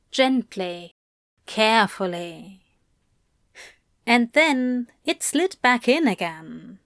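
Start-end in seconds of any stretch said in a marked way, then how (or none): none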